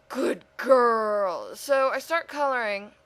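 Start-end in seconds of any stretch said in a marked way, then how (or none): 0.1-1.5 louder, mock pet-owner praise; good-dog praise aimed at yourself; praising a pet. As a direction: pat yourself on the head like a puppy.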